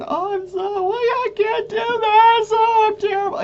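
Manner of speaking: high, whiny imitation of herself in tears; mock despair.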